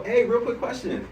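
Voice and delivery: bright, friendly stop-them call; upbeat sales opener. Shown as a demonstration. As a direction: greet them cheerfully. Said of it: "you gotta go".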